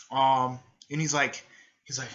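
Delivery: ordinary delivery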